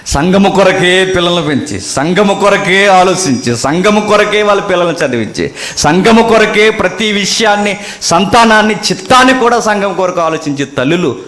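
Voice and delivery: rising preacher's chant; building sermon litany. Acted. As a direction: hammer the repeated refrain.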